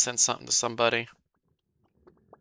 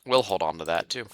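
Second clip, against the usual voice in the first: normal talking voice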